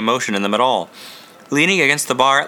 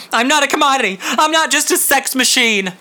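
anguished cry; 12 semitones higher than usual. Acted. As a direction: cry it out in despair, voice climbing. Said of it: "came out in an anguished cry".

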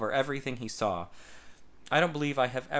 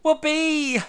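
joyful whoop; 8 dB louder than usual. A narrator's reading. Son shouts with joy in cafe.

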